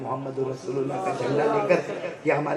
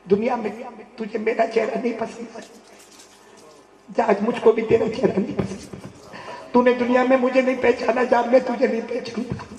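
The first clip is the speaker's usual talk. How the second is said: higher, rising rebuke of a disowning voice; a hint of a stern judge turning away; 8 semitones higher, 4 dB louder than usual. Voicing the Prophet's rebuke. (سخت ناراضگی کا ہلکا سا لہجہ)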